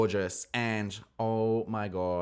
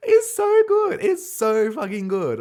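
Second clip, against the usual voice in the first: high delighted shriek; excited squeal; 12 dB louder than usual. Real, not acted. Squealing delight after the read.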